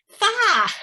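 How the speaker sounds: sharp high outcry of complaint; the nurse yelps at her aching bones; exclaimed Fie. Acted. Nurse to Juliet, a high pained cry of complaint.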